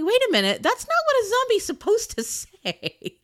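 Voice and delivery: high, loud protest breaking into a laugh; retold disbelief. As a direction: retell it amused and incredulous. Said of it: "I'm like".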